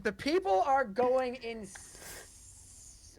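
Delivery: slow, stretched-out showman delivery; drawn-out dramatics. Acted. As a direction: draw out the words for drama. Game master hams crowd reaction.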